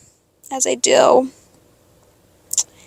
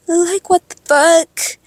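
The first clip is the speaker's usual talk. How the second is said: normal talking voice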